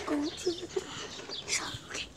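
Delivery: unvoiced whisper. Real, not acted. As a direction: whisper so the dogs don't hear. Sneaking up whispering.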